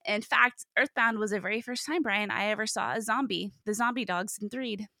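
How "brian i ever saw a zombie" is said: normal talking voice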